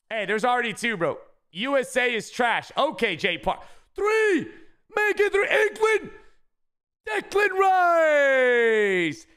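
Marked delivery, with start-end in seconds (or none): 4.0-9.3 will the pack to deliver; stretched, soaring final call; hype shouting. Real, not acted. Calling for third pull.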